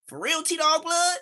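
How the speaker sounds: high, drawn-out amazed question; amazed disbelief. Retold or imitated. Smiley asking the teller in disbelief, high and amazed. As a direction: squeal it like you can't believe it.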